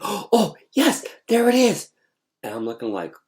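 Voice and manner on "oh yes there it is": high, louder, excited discovery. Retold or imitated. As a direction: pitch it up like finding treasure.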